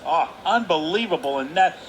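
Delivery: usual speaking voice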